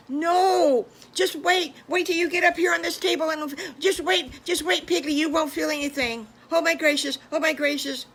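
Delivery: high, loud and fast piglet squeal; excited piglet boasting. Acted. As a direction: crow with glee at your brother. Piglet boasting to his brother.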